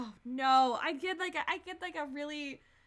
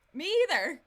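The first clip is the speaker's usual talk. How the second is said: louder, higher exasperated outburst; emphatic agreement; 4 semitones higher, 7 dB louder than usual. Real, not acted. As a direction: punch out the agreement in disbelief. Exasperated repeat of agreement, louder take.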